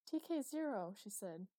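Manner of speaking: a small shocked catch at a discovery; gasping a little. A narrator's reading. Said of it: "She gasped a little".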